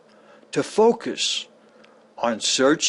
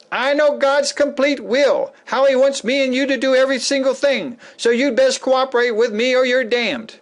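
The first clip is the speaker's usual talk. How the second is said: higher, faster, lordly decree; mock religious bully, pitched up; arrogant person; 8 semitones higher than usual. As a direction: sneer it like a know-it-all. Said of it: "of the arrogant person".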